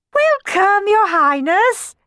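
very high, loud, drawn-out welcome; gushing greeting. A narrator's reading. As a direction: fawn over royalty at full volume. Gushing greeting to supposed prince.

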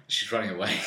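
usual speaking voice

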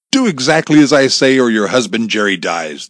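loud, forceful order falling away; menacing caller laying down terms; commanding threat. A narrator's reading. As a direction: bark out a threat over the phone. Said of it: "the voice on Amy's cell phone commanded".

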